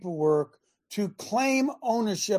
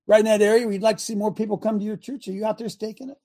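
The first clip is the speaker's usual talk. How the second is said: ordinary talk, nothing marked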